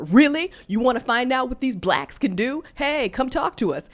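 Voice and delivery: put-on loud, high challenge to the girl; loud mock dare to step up; retold confrontation. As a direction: square up and call her out, loud. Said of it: "I turn around and say to her".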